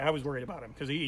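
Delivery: normal talking voice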